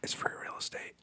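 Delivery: unvoiced joking whisper. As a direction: hiss it like a meme. Meme line delivered as a joke.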